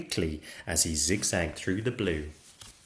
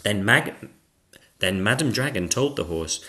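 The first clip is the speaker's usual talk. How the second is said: plain reading voice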